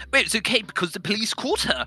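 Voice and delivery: high put-on voice, oddly unconcerned; casual housemate imitation. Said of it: "he was completely fine with it 'cause he replied with".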